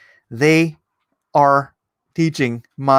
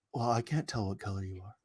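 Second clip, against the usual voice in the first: much softer, put-on dopey voice; mocking student voice; 4 semitones lower, 16 dB quieter than usual. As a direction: mimic the clueless kid, deadpan. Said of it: "the kid's like".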